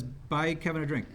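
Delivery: ordinary talk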